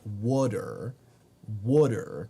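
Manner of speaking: "wooder" with oo as in wood; Baltimore pronunciation. Shown as a demonstration. Streamer showing how Baltimore says it, "wooder" with oo and D. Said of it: "how people in Baltimore say it".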